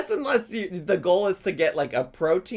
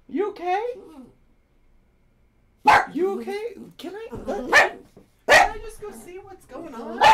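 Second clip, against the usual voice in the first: soothing pet talk; 12 semitones higher than usual. Acted. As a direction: hush a scared pup gently, pitch up. Soothing dog after buzzer scare.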